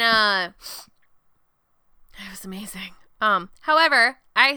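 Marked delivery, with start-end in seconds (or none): 2.1-2.9 a reverent, hushed whisper of delight; awed whisper. Real, not acted. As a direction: breathe it out in a whisper. Whispering about delicious brisket.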